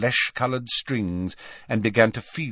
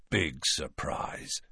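low, slow hushed mutter; whisper; 6 semitones lower, 10 dB quieter, slower than usual. A narrator's reading. Ron to his friends about Malfoy's turn, low whisper. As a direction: whisper it drily under your breath. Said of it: "whispered Ron".

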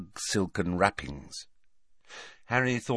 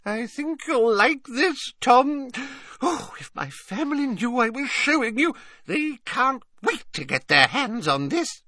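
put-on doting old lady. A narrator's reading. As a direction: fuss over him like a grandmother. Old witch showing treasure.

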